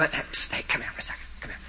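hushed, furtive whispered beckoning; acted-out sneaky "psst" whisper; secretive whisper. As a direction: whisper as if luring someone over on the sly. Sneakily beckoning the child over.

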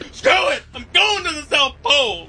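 high-pitched mock-exasperated cry of a jilted man; mock defiant outburst. Comic imitating a heartbroken explorer, mock defiant outburst. Voices dumped explorer storming off.

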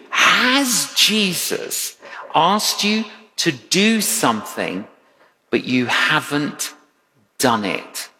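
slow, weighty questions with long pauses; slow probing questions. Real, not acted. Preacher asking the congregation, slow and weighty. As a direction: let each question land slowly.

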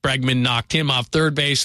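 ordinary talk, nothing marked

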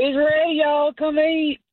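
hammed-up drunk brother calling out dinner. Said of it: "that's my drunk brother voice".

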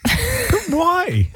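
very high, wailing plea; hammed-up squeal of protest; mock hurt. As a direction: whine it in playful despair. Host protests playful rejection.

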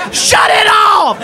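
high strained cry through laughter; dying laughing. Acted. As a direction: beg it out long and high while cracking up. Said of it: "he's dying".

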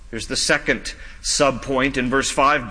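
usual speaking voice

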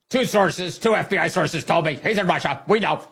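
high, overconfident protest; mock insistent reporter. Host as reporter answering "How do you know?", mock insistent. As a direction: insist on it in a high, pushy mimicry. Acts reporter defending rash claim.

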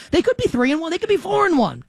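high-pitched protesting fan; mock indignant fan. Host imitating angry Giants fans defending their team, high indignation. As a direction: argue upward in mock outrage.

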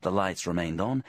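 ordinary delivery

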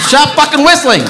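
loud, high, furious yell through a laugh; angry yell. Real, not acted. Comic yelling at an audience member whistling, loud and furious. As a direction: snap at him to stop, half laughing. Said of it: "that was a little aggressive".

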